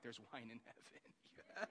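laughing delight. Retold or imitated. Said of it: "I'm like".

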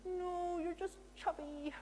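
a friend's light, playful comfort. Retold or imitated. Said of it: "She said".

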